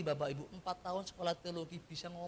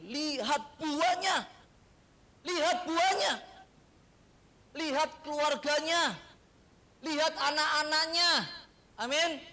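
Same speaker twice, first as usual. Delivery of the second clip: emphatic preaching; 9 semitones higher, 8 dB louder than usual. Retold or imitated. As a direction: hammer each line home from the pulpit. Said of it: "Makanya seringkali saya bilang". (seruan keras penuh tekanan)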